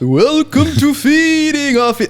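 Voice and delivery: high, slow vampire greeting; spooky voice. Acted. Host opens the podcast in a high, slow spooky voice. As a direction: draw the welcome out, up high. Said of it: "take turns doing our spooky voices".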